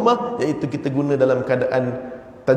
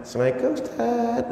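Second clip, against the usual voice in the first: higher, softer, gentle greeting; soft polite greeting; 8 semitones higher, 5 dB quieter, faster than usual. Retold or imitated. Pupil greeting the teacher in a soft polite voice. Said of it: "Ah, kan lembut".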